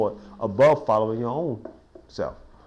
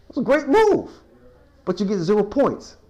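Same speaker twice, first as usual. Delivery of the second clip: excited; 9 semitones higher, 4 dB louder than usual. Retold or imitated. Basketball missed shot punchline.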